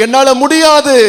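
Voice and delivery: high, loud cry of "I can't"; loud refusal. Acted. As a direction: Moses crying out that he can't. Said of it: "Moses refusing God".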